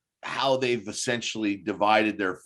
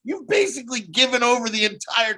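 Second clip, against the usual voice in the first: higher-pitched mock of a pathetic official; raised-pitch, mocking indignation; pathetic mayor; 6 semitones higher than usual. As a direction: mimic a weaselly official's pompous protest. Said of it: "in this scene is so pathetic".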